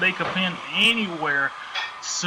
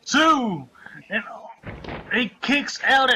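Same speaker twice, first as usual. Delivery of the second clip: counting the pin; 6 semitones higher than usual. Real, not acted. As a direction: count it out, building to the kickout.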